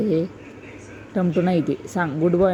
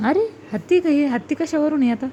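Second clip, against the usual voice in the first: mock surprise; 6 semitones higher than usual. Real, not acted. Mother questions child's wrong answer.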